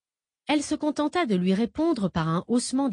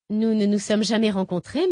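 usual speaking voice

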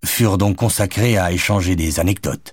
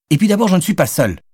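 high, fast, triumphant comeback; defiant retort; 12 semitones higher, 4 dB louder, faster than usual. A narrator's reading. Madeleine countering Jonathan's argument, high, fast and defiant. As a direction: snap back with a point scored. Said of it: "assura-t-elle".

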